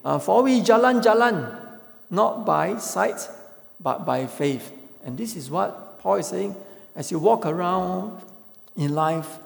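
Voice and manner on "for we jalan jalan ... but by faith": high, loud Singlish take on jalan-jalan; Singlish version. Shown as a demonstration. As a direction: say it like a Malay friend in Singlish. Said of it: "use the Singlish or Malay".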